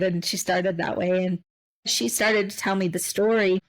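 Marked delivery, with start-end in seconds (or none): none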